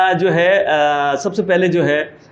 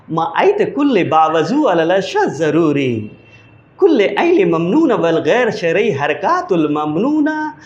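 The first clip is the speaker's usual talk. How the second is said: slow gibberish Arabic airline announcement; mock Arabic announcement. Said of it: "एक अंदाज है उनका ना". (नकली अरबी अनाउंसमेंट)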